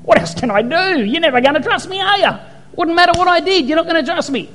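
high-pitched, fed-up pleading; mock exasperation, pitched high; exasperated God. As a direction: play God at the end of his patience. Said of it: "I'd go after a while, go".

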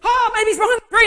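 high, loud eager guess; excited kid's guess. Retold or imitated. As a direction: blurt it out like a thrilled child. Kids searching, excited guess.

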